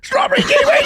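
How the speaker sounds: very high, loud shriek of "Strawberry kiwi!"; mocking a drunk friend, shrieking the flavour; squeaky shrieked quote. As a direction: shriek it like a giddy girl. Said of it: "she's like".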